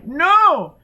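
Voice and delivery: put-on high, loud child's cry; frightened child. A narrator's reading. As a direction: squeal it like a scared little girl. Child refuses again.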